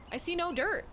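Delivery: high mock disbelief. Shown as a demonstration. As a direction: say it again like you can't believe it.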